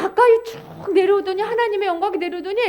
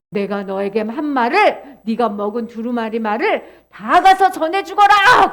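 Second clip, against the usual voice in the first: slow, solemn charge building louder; God commanding; 5 semitones lower, 5 dB louder than usual. Acted. God telling Ezekiel to deliver the scroll's words, slow command. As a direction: begin low, end in a forceful order.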